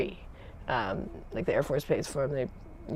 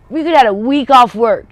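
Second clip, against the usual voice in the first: loud, higher, exasperated tirade; furious supervisor; 6 semitones higher, 12 dB louder than usual. Retold or imitated. Supervisor scolding her for missing the week off, furious loud rant. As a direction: chew her out for costing the week off. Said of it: "he was so mad".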